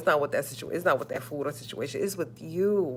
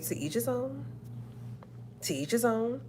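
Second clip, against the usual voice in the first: soft shrug; 5 semitones higher, 9 dB quieter than usual. Retold or imitated. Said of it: "I be like".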